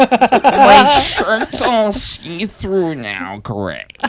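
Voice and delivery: very slow, high, dropping on the name; milk it coyly while half laughing; mock coy dismay. To stepbrother at the sink, mock coy dismay. Joins dishwasher stepbrother skit.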